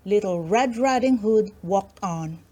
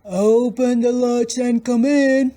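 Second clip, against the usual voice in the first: wolf faking granny; 4 semitones higher, 5 dB louder than usual. Acted. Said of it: "Trying to imitate Grandma's quavering little voice".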